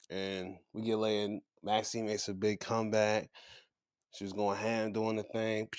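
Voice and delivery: ordinary talk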